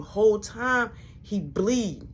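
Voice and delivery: ordinary delivery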